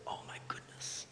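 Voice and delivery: stunned, whispered dismay; very soft, crestfallen whisper; mock grief. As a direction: imitate someone overcome, barely getting it out. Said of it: "his face is overcome with grief".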